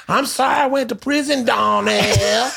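hammed-up put-on accent, wailed high; Bobby. Host imitating Bobby playing a father, high mock accent. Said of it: "very inappropriate accents of each other's race".

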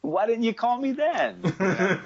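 squeaky, high complaint; high whiny protest. Retold or imitated. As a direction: whine it up high, half laughing. His own retold whiny reply.

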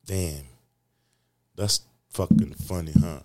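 normal talking voice

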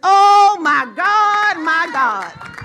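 full-force preaching. Acted. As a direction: build it to a roaring climax. Preaching burst.

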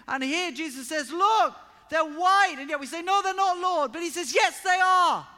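high, loud impassioned preaching; preaching at full force. Acted. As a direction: drive it home to the congregation, full voice.

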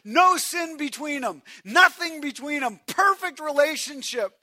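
high, loud, emphatic preacher cry; preaching hard at full voice; forceful preaching. Real, not acted. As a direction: drive each phrase home to the congregation.

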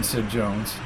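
ordinary delivery

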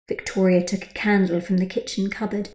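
plain reading voice

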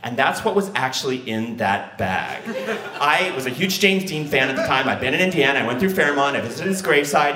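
normal talking voice

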